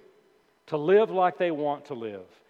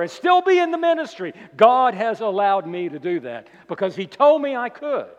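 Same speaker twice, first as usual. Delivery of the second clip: loud mock justification, rattled off as a list; emphatic parody of a self-excusing minister; false teacher's claim; 2 semitones higher, 7 dB louder than usual. Preacher mimicking false teachers excusing divorce, loud mock claim. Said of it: "they might say".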